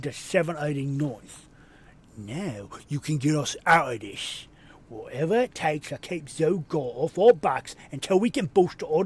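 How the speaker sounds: put-on bossy sea-dog in a pirate accent, with loud bursts; gruff pirate commander. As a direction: play the irritated captain handing out a dangerous job. Reads a gruff NPC commander.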